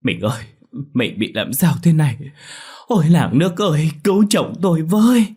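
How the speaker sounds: anxious call building to a high, loud wail; a wife crying out in panic for her husband; frantic with worry. A narrator's reading. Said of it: "những câu nói đầy nỗi lo lắng".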